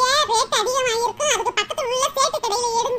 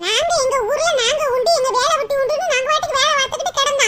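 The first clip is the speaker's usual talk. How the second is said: normal talking voice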